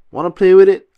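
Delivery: high, loud cheerful SpongeBob; loud high excited invitation; eager offer. Acted. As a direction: pitch it up like a kid sharing a toy.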